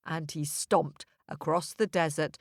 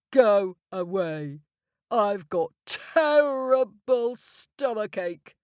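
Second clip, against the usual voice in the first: grumpy giant; 2 semitones higher, 4 dB louder than usual. Acted. Giant with stomachache.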